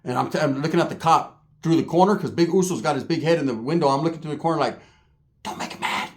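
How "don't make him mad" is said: whispered plea under the breath; a hushed, urgent plea; mock whisper. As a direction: hiss it at the cop so Lion won't hear. Silently pleading with the cop.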